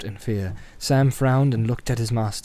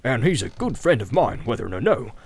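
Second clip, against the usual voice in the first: rambling innkeeper, pitched up in bursts; Butterbur, garrulous and fretful; 3 semitones higher than usual. Acted. Butterbur worries what the wizard will do, garrulous and fretful. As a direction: chatter on, fretting about what's coming. Acts Butterbur talking of Gandalf.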